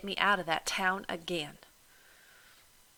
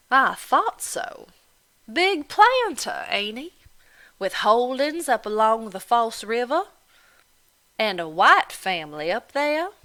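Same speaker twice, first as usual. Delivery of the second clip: mocking Irish landlady; 5 semitones higher, 8 dB louder than usual. Landlady goading Delphine about her planter father, loud and mocking. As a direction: needle her with each question.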